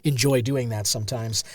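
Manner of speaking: just after a slurp of coffee; soft and low after a sip; sipping coffee. Real, not acted. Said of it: "Pardon the slurp".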